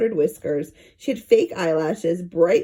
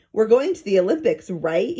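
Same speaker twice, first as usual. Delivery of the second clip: plain reading voice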